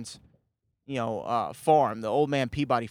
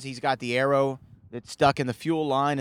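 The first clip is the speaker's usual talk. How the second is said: usual speaking voice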